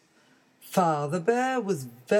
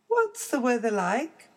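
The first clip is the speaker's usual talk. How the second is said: put-on baby bear voice; 7 semitones higher than usual.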